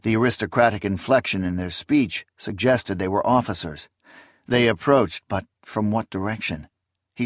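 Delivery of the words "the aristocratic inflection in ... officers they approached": plain reading voice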